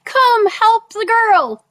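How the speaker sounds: high, drawn-out call for help; acted yell, pitched high and slow; retold yell. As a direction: call across to onlookers, stretching each word. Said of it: "he yells out".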